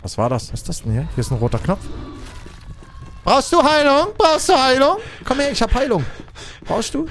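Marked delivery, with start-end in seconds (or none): 3.2-7.1 high friendly call; helpful player offering heal. Pretend. Survivor offers healing to teammates, high helpful call. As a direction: coax a hurt player over.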